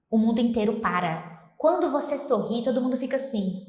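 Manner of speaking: ordinary talk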